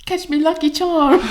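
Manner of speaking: high mock Irish leprechaun call. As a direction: squeal it like a cartoon leprechaun. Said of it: "he was just gonna say".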